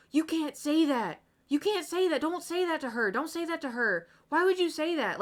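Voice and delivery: high, loud mock-horrified protest; shocked classmates' outcry. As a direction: imitate a whole class scolding in shock. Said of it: "everyone in the class gasped".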